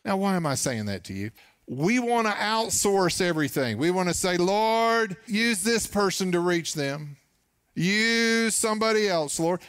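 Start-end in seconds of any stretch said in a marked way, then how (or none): 4.4-9.6 higher, pleading, a bit whiny; mock pleading prayer. Pastor mimicking believers who want God to use someone else, pleading.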